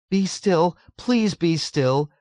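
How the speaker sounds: high, helpless tearful plea; pleading through sobs. A narrator's reading. Nurse failing to restore order, pleading tearfully. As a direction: beg them, close to tears. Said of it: "urged uselessly through her massive sobs".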